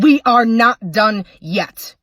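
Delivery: slow, loud threatening yell; husband yelling at his wife on the honeymoon, slow and threatening. Acted. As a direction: spit each word out slowly at her.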